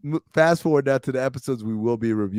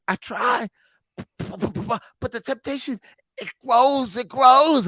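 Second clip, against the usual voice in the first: high, strained voice of an addict confessing to a priest, slow at first; anguished addict; 10 semitones higher, slower than usual. Retold or imitated. Prisoner confesses his craving to McManus, high and strained. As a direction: plead that the craving keeps growing, voice climbing. Acting Omar's line to McManus.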